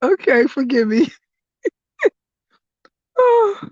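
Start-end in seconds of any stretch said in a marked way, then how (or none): none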